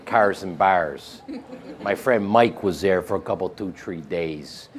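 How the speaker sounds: low, broad Chicago accent; hardcore Chicago accent. Shown as a demonstration. Actor showing what a Chicago accent sounds like, hardcore Chicago accent. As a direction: lay on a thick Chicago accent. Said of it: "a Chicago accent is very hardcore".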